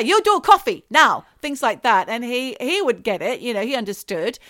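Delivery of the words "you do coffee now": loud, high mock Chinese accent; put-on Chinese accent, punched out loud. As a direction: imitate a blunt Chinese-accented demand. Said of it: "She'd say".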